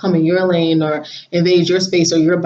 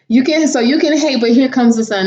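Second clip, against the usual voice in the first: emphatic; 6 semitones higher, 4 dB louder than usual. Shown as a demonstration.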